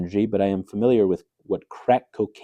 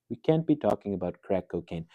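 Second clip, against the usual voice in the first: normal talking voice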